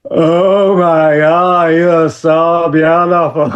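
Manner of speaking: drawn-out lovestruck gush; boy smitten at the door; awestruck. Retold or imitated. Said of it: "And I'm like".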